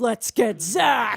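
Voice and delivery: slow, loud, imitating demon voice. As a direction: drag it out like a growling demon. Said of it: "That's the guy that said".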